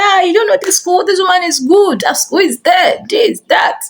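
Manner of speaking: enthusiastic neighbor. Retold or imitated. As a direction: act out the woman talking up the school. Acting out the neighbor praising school.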